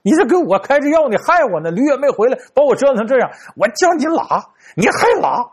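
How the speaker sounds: put-on an old man blaming the fortune teller for his trouble, angry; cursing the fortune teller. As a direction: accuse him, rising to a peak. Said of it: "骂小神仙呢".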